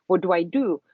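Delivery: ordinary talk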